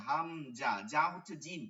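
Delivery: ordinary talk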